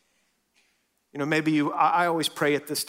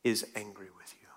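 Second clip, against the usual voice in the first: whisper; 5 semitones lower, 8 dB quieter than usual. Acted. Sermon climax whispered.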